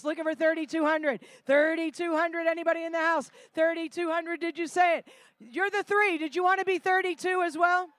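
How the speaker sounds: lively auction calling; rally the room for bids; auctioneer. Acted. Live charity auction calling.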